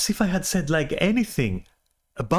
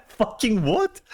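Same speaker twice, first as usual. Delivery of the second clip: incredulous laugh; 11 semitones higher, slower than usual. Real, not acted. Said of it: "disbelieving laugh then outburst".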